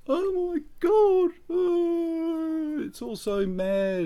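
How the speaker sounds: shrill-high, slow mock wail; panicking spouse. Spouse freaking out over the crisis, hammed-up high mock wail. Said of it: "your spouse turns around to you and goes".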